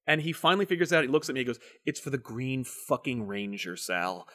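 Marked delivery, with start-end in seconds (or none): 1.9-4.3 softer, slow disgusted letdown; deflated mutter. Retold or imitated. Friend Ethan telling Sal, slow soft deflated complaint. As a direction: let the air out in disgust.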